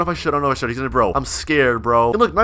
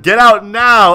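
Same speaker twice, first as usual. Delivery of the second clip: loud, raised acted command; mock angry order; 5 semitones higher, 7 dB louder, slower than usual. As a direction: order them out in pretend anger. Acts out owner's order.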